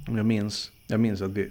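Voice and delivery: ordinary delivery, nothing marked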